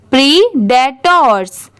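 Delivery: normal talking voice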